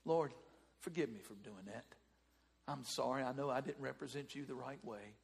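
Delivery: hushed low confession to the Lord; remorseful prayer. Acted. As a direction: pray quietly for forgiveness. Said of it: "Now what do you do?".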